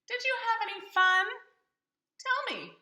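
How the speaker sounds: put-on mother asks the children; mother's voice. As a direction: ask like a cheery mom.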